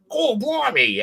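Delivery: botched Cockney drifting Australian; high, exaggerated attempt at Cockney; mock Cockney. Friend imitating a London mate's friends, mock Cockney. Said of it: "were very Cockney and had that".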